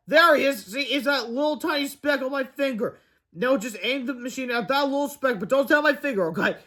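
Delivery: lightly acted excitement; eager character voice. A narrator's reading. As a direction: point it out to a friend. Character excitedly points out speck.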